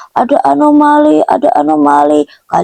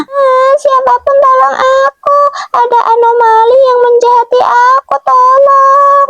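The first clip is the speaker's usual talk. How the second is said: frightened cry for help; 13 semitones higher than usual. Acted. Victim calls for help against an anomali, wailing in fear. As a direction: wail for help in a high, acted panic.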